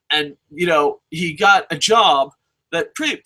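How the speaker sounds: ordinary talk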